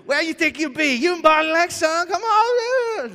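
very high, loud comic challenge; exaggerated scolding voice. Pastor parodying disbelieving friends for laughs, exaggerated scolding voice. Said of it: "if we all talk like that".

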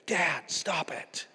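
slow, drawn-out mock protest; imitated annoyed kid. Preacher imitating his kids protesting to dad, annoyed. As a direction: drag it out like a fed-up kid. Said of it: "when they're mad and screaming".

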